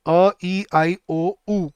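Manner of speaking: "अ, ई, आई, ओ, ऊ" as separate pure vowels; Hindi vowel list. Shown as a demonstration. Teacher reminding students of vowel sounds, "अ, ई, आई, ओ, ऊ" each separate. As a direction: recite "अ, ई, आई, ओ, ऊ" one sound at a time. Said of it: "Hindi वाली".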